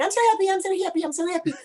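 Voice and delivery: fast, high, giddy repeated gushing; excited fans. Retold or imitated. Imitating Facebook fans freaking out with excitement. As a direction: squeal it like overjoyed fans. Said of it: "they were, like, freaking out and saying".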